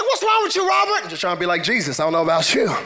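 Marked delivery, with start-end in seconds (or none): 0.0-1.0 high-pitched mock scolding; shocked congregant. Church member to preacher acting up, mock shocked. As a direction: question him high, in mock alarm.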